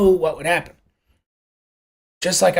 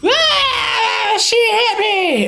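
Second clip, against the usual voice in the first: put-on high-pitched, drawn-out wailing child; loud mock bawl of a tattling toddler; screaming crying kid; 4 dB louder, much slower than usual. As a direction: cry it out like a hurt little kid. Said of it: "One comes running up screaming and crying".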